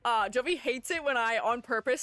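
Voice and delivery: usual speaking voice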